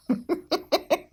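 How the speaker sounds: high laugh bursting out; real amused laugh; burst of laughter. Real, not acted. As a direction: laugh at the absurd line. Reacting to absurd fanfic line.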